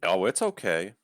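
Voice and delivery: ordinary talk, nothing marked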